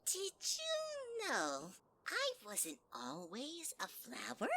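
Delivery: slow, sweet and sneaky flower voice; a cute creature hiding menace; sly little flower. Acted.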